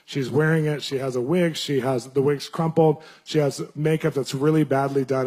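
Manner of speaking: ordinary delivery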